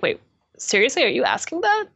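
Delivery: retold "are you serious" reaction, pitch climbing; imitated disbelief. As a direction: mimic a raid leader who can't believe the question. Retelling raid leader's disbelieving reply.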